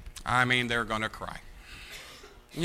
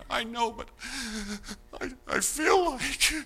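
mock whiny voice; 9 semitones higher, slower than usual. Imitating whiny counselee.

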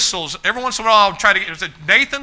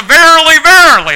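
much louder, higher verily verily; attention call; 11 semitones higher, 13 dB louder than usual. Retold or imitated. Preacher compares Jesus' verily to a loud whistle. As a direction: ring it out like a whistle. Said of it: "That's a".

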